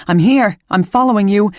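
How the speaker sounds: high, loud calls through the tunnel; shouting while running. A narrator's reading. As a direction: keep calling out as you chase. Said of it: "me shouting the whole time".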